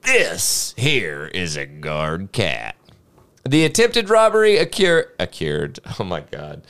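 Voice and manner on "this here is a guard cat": put-on old man voice. Quoted old man in article.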